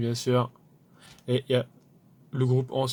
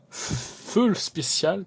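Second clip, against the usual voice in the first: "full spécial" said the French way, slowly, "full" drawn out and rising; mock French accent on "full spécial", dragged out; 7 semitones higher, 3 dB louder, much slower than usual. Shown as a demonstration. Fan to viewers, mocking the French way: "full spécial" in a French accent. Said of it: "comme diraient, euh, les Français France, avec un accent bien français".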